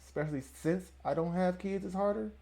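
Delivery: confused, quiet echo stressing harder; puzzled re-read. Shown as a demonstration. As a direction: read it back in disbelief.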